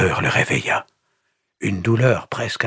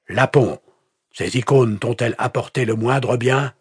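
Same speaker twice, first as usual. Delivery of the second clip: high, forceful questioning; stern interrogation; 6 semitones higher, 4 dB louder than usual. A narrator's reading. Pastor challenging the captive's faith, stern interrogation. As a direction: challenge him with contempt.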